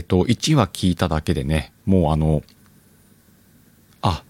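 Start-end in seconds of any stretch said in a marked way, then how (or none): none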